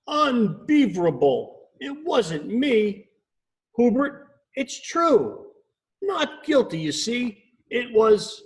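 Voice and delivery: high, huffy beaver voice. Acted. Beaver huffing her denial at the owl. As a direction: huff your denial up high. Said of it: "Becky huffed".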